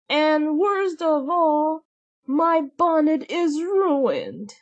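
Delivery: high, slow whine; hammed-up high, drawn-out wail; whiny stepsister. A narrator's reading. Stepsister complains.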